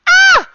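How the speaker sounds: very high, very loud "Ah!"; sudden full-force high scream; yell. Acted. Player acts out their character's attack yell, screaming. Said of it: "I want to yell".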